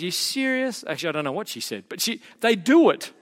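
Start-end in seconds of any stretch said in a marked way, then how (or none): 0.0-0.8 high, incredulous question; wife doubting her husband in high mock disbelief. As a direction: ask it like she can't believe it. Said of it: "But Mary just goes".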